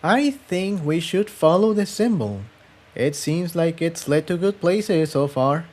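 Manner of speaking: lightly voiced girl proposing a path; young mare voice. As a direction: pitch up as the hopeful companion. Tiptoe suggesting.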